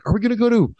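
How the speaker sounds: a hint of an aggrieved academic venting; frustrated Sam Harris. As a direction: ham up a put-upon expert's rant. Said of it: "he's just so frustrated".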